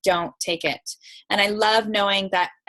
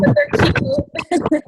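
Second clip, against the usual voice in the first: high playful; 5 dB louder, much slower than usual. Real, not acted. Teasing about the headphones.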